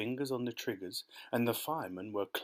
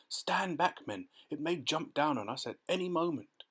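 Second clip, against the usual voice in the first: high, urgent warning without full volume; yelled warning; 6 semitones higher than usual. A narrator's reading. Police chief warns his men back, high alarmed yell. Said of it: "yelled the chief of police".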